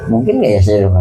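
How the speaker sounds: ordinary delivery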